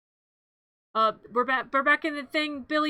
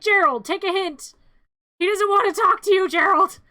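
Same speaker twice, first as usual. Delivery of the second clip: scolding Gerald; 6 semitones higher, 7 dB louder than usual. Real, not acted.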